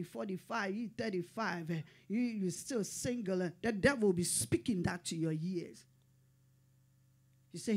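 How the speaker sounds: ordinary talk, nothing marked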